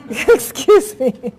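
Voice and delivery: laughing nervously through an apology; flustered giggle, then excuse me. Real, not acted. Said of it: "I'm a little nervous".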